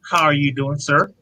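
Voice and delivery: ordinary talk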